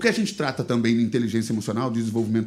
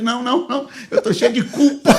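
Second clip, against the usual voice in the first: loud, high comic refusal; retold panicked protest; 8 semitones higher, 8 dB louder than usual. Pastor tells himself he is too guilty to go, comically panicked. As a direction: protest loudly, high-pitched, hamming up the guilt. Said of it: "eu falei pra mim mesmo".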